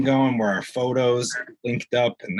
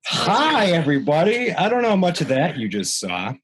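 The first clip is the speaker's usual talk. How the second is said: show host intro; 7 semitones higher than usual. Acted.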